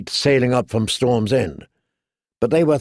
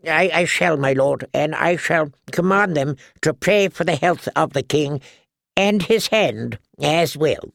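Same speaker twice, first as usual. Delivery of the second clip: put-on halting, higher old cleric voice; a lightly voiced meek old churchman; timid old priest; 4 semitones higher than usual. High Septon answers Tyrion.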